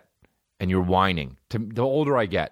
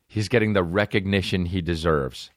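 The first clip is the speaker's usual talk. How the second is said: ordinary delivery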